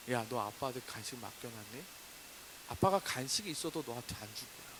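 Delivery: ordinary talk, nothing marked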